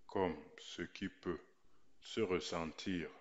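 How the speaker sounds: usual speaking voice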